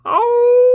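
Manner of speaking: very high, very loud long wolf howl. Shown as a demonstration. Showing viewers a wolf howl, loud high howl. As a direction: throw your head back and howl. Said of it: "let out a long, slow howl".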